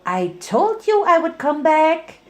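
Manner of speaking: high, bubbly smiling voice; high chirpy Pinkie voice; smiling Pinky. Acted. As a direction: say it with a grin. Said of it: "smiled Pinky".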